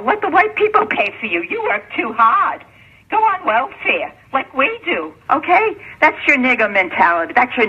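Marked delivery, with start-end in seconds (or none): 0.0-5.8 high mimicry, slowing down; imitating someone's coaxing, pitched up; mocking retold advice. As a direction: urge it in a raised, mocking pitch. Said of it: "you know what they told her".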